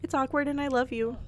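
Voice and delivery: ordinary talk, nothing marked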